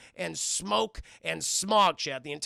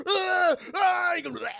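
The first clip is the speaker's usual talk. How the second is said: loud high gagging screams; mock horrified shriek; Michael realising he is eating maggots; disgusted scream; 13 semitones higher, 8 dB louder than usual.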